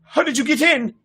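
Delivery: high, loud panicked questions; a scared boy faces a monster in his room, high panicked questions; terrified. A narrator's reading. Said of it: "his expression terrified".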